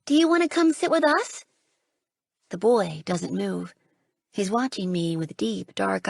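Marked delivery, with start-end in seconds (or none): 0.1-1.4 calling out. Acted. Calling to the boy in snow.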